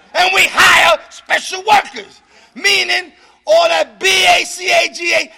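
loud, slowed preaching shout; full-force preaching. Acted. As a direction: hammer the tradition home.

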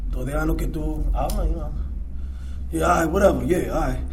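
low, soft, unbothered calm; calm sponsor reply. Retold or imitated. Sponsor answering him, soft and unbothered. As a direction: let him talk himself out, softly. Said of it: "Entonces me dice".

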